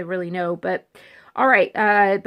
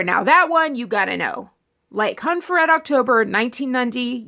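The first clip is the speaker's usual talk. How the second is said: usual speaking voice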